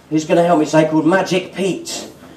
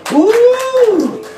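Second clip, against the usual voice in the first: excited whoop; 13 semitones higher than usual. Acted. Pencil flourish.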